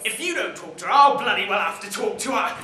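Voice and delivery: loud bellow from the background; angry shout. Acted. Dad shouting that he'll speak to Anne himself, angry. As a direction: threaten to take over the call.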